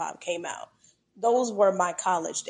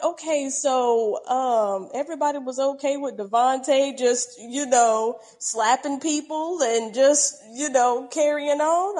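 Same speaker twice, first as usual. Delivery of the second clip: incredulous; 5 semitones higher, 5 dB louder than usual. Retold or imitated. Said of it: "I'm like".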